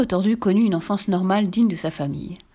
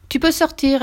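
high, raised call through the door; announcing; 7 semitones higher, 4 dB louder than usual. A narrator's reading. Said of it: "annonça-t-il, annoncèrent-ils enfin". (annonce à voix haute)

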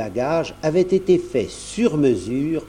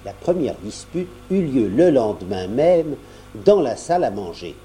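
ordinary delivery

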